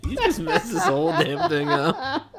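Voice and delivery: words squeezed out through laughter; laughing. Real, not acted. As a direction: talk while cracking up. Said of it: "I can't get through it".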